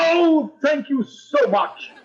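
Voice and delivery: high, slow gushing thank-you with a laugh. Acted. Principal to teacher over the gift, gushing. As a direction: overdo the delight as if it's for him. Principal's delighted mock gratitude.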